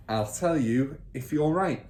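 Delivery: ordinary delivery, nothing marked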